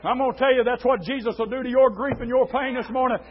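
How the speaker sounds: ordinary talk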